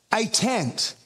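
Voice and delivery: normal talking voice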